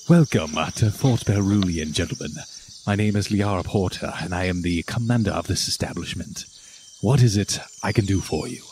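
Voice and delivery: lightly voiced stern commander; Liara.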